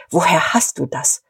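ordinary delivery, nothing marked

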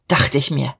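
usual speaking voice

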